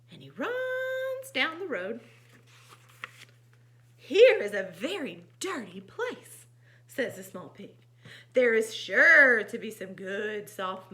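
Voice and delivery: animated, loud exclamations between narration; lively storytime voice; story narration. A narrator's reading. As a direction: read to children with dramatic emphasis. Reads picture book aloud.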